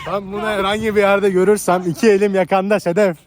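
loud furious threat; angry threat. Acted. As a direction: bark the threat loudly. Acted threat to Sedef.

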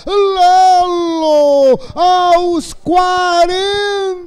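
long stretched goal yell; explosive goal commentary. Real, not acted. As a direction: drag out the goal call. Radio goal call.

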